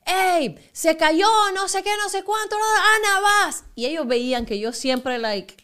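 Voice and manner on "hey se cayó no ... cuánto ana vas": excited call. Retold or imitated. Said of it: "De repente decían".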